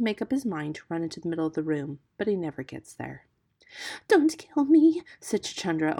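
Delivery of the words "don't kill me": high, loud tearful plea; almost weeping. A narrator's reading. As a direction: beg for your life as a timid muskrat. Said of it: "almost weeping".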